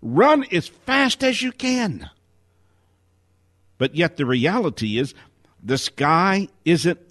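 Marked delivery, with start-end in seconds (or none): 0.0-2.1 mock fearful scream. Speaker voicing fear's warning that the sky is falling, mock fearful scream. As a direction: cry out a warning in pretend panic. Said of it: "of fear screaming".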